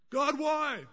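high, pleading cry of why; anguished cry to God. Acted. Preacher voices prophet's anguished cry.

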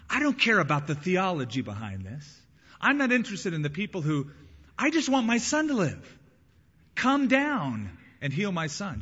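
acted father's desperation, rising in pitch; desperate plea. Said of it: "hear the desperation in his voice".

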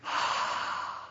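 long airy exhale; acted sigh of relief; refreshed sigh. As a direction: breathe out as if minty fresh. Acts out feeling refreshed.

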